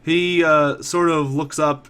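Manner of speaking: ordinary talk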